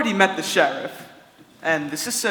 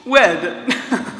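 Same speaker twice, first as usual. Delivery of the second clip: louder mocking laugh at the idea; scoffing laugh; 6 semitones higher, 5 dB louder than usual. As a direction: laugh off the question. Prince scoffs at marriage.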